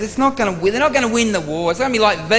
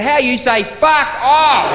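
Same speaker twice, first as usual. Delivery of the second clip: mocking retort; 8 semitones higher, 6 dB louder, slower than usual. Mocking Stefan's phrase.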